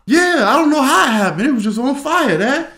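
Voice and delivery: high, loud mock-innocent kid voice; pleading child. Guilty son explaining the fire to dad, pleading.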